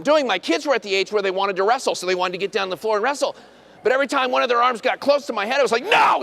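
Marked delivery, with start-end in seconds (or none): 5.8-6.2 sudden high, loud shout; panicked yell. Retold or imitated. Dad yelling at kids reaching for his hairpiece. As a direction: bark to stop the kids cold. Stopping kids near wig.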